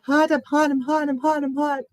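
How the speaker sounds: high-pitched panicky chant of complaint; mock overheated complaint. Telling viewers how she reacts at seventy degrees, mock overheated complaint. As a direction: whine it high, on one repeated note. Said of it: "and I go".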